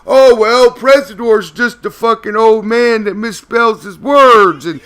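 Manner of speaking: high, loud ranting shout; mock angry rant. Pawn Star blasting Presador back, a hammed-up high shout. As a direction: rant like a mocked YouTuber losing it. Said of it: "He cannot sit there and say".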